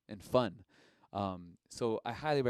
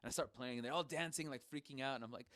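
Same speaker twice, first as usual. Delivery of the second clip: ordinary talk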